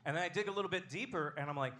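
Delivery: normal talking voice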